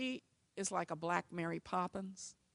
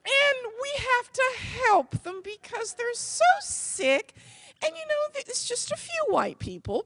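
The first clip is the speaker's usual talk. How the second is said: pitying mom voice; 9 dB louder than usual. Retold or imitated. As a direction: sweetly pity the poor sick people. Mom pities racists to child.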